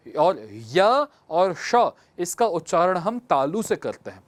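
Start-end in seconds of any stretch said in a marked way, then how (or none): 0.1-1.9 slow letter demo. Shown as a demonstration. Teacher slowly spells out palatal letters for students. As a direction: stretch out य and श very slowly. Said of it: "प्रयोग करके देखिए".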